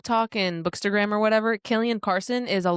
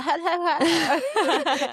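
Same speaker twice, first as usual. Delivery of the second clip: high forced "ha, ha, ha"; mock laugh; 8 semitones higher, much slower than usual. Imitating her own laugh while reading Remington's jokes. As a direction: fake a bright laugh at a lame joke. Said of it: "I was like".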